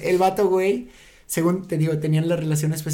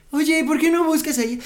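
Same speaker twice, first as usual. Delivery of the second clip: imitating Mike; 8 semitones higher than usual. Friend quoting Mike's suggestion.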